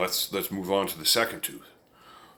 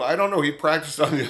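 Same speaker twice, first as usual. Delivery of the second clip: normal talking voice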